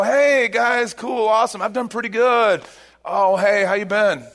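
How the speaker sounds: mock-cheery, pretend all is fine; fake cheerful. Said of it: "He didn't just see his brothers and go".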